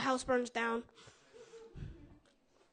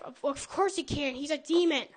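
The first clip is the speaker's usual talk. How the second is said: normal talking voice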